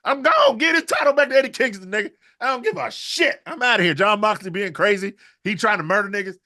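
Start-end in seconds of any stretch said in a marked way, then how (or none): 0.1-3.9 high, loud eager quitting; excited mock Okada. Host voicing Okada happily leaving, high and loud. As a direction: shout it gleefully, already out the door. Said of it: "he gonna be like".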